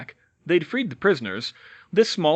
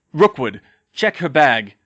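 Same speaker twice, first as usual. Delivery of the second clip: louder, clipped command to a henchman; curt order; 9 dB louder than usual. Acted. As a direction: bark the order at Rookwood. Lucius giving a curt order.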